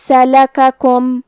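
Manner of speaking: سلککم chanted slowly, each short vowel equal; even short vowels. Shown as a demonstration. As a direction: give every vowel of سلککم the same length, slowly. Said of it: "سب حرکات کو برابر سے ادا کرنا ہے". (سلککم کی سب حرکات برابر، ٹھہر کر)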